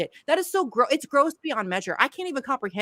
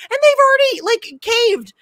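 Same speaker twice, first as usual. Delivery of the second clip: much higher and louder, incredulous; exasperated outburst; 11 semitones higher, 8 dB louder than usual. Real, not acted. To her co-hosts about the squad caving, an exasperated high burst. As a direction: blurt it out in disbelief.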